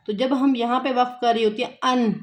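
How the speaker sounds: ordinary talk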